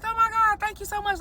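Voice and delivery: high, gushing thank you. Retold or imitated. Thanking stranger for compliment.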